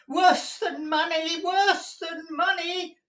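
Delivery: put-on despairing old miser's wail; crying out. A narrator's reading. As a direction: cry it out in anguish, very high. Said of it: "cried the old man".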